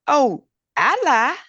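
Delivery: a hint of an eight-year-old confessing; mock child voice, slow and high; little girl. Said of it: "And she said".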